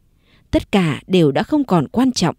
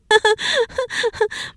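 sharp high bitter laugh. Acted. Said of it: "Diệu đứng bật dậy cười". (tiếng cười chua chát)

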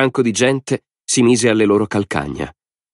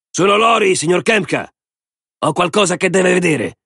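high-pitched call through a door; raised, high voice calling to someone indoors; 11 semitones higher than usual. A narrator's reading. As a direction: announce yourself to the boss inside. Lori calls through the door.

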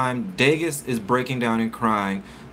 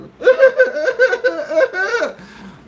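high burst of real laughter; sudden high laughing fit; 8 dB louder than usual. Real, not acted. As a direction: break into loud giggles. Laughing at own pillow joke.

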